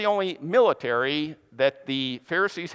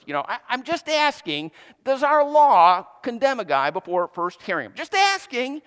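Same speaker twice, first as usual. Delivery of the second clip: defensive innocence; 4 semitones higher than usual. Retold or imitated. Nicodemus defending himself before the angry Pharisees, defensively high. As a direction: plead you're just asking, all innocent. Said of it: "Nicodemus's imagined defensive speech".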